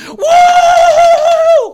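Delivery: long, high, loud "Woo!"; sustained full-voice crowd howl; wild whoop. Retold or imitated. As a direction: whoop like a rowdy hockey fan. Imitating his wild hockey-game cheering.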